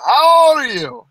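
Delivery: high, loud, drawn-out demand; insistent shouted question. Real, not acted. Interrupts a young man to demand his age, insistent shout. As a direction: cut in loudly, stretching the question out.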